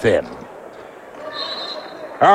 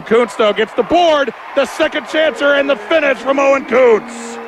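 excited play-by-play; 12 semitones higher than usual. Real, not acted. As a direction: pitch climbing to a thrilled shout. Second-chance score, excited call.

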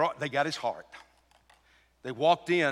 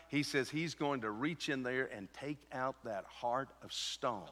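normal talking voice

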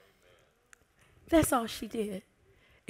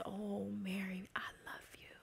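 soft, whispered loving reassurance; tender whisper; 15 dB quieter than usual. Retold or imitated. Jesus comforting ashamed Mary, tender whisper. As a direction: breathe it gently like comforting someone.

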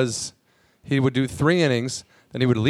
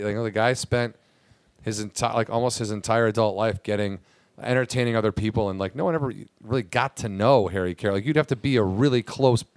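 usual speaking voice

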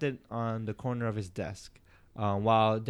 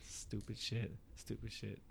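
soft, slow disgusted mutter; 13 dB quieter, slower than usual. Retold or imitated. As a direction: mutter it under your breath. Mocking teacher line.

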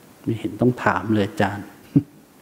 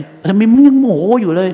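high, loud mock angry outburst; angry snap; 9 semitones higher, 6 dB louder than usual. Meditator yelling at his own anger, hammed-up. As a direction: shout at yourself for still being angry.

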